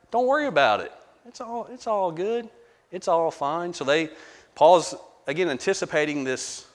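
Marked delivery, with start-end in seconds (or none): 0.1-3.7 high, breezy mock shrug of a voice; carefree dismissal. Teacher voicing a careless believer's excuse, high and breezy. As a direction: brush it off like nothing matters, mocking. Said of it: "We might say it this way".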